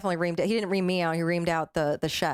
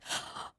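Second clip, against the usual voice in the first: sudden unvoiced gasp of horror; a startled, breathless gasp; sharp gasp; 13 dB quieter than usual. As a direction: suck in air in shock. Sees the guest eating nuts.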